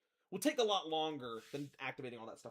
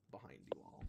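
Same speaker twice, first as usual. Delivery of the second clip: ominous whisper; 13 semitones lower, 8 dB quieter than usual. A narrator's reading. GM ominous narration.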